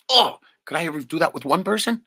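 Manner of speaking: loud, high wondering exclamation; amazed self. Retold or imitated. Remembering mother's gift, loud high amazement. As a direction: burst out at the thought. Retold own thought, exclaiming.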